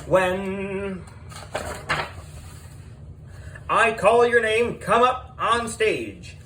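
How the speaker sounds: high, booming host announcing the contestants; game show host. A narrator's reading. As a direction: call them down like a TV quiz host.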